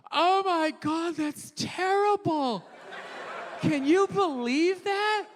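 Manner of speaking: slow, high pearl-clutching gasp; drawn-out mock horror, hammed up; mock liberal shock. Mocking liberals, slow high mock horror. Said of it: "what's the best we got".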